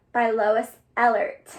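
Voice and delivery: usual speaking voice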